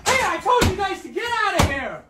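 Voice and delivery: high, exasperated order; angry scolding. Real, not acted. Parent ordering kids out.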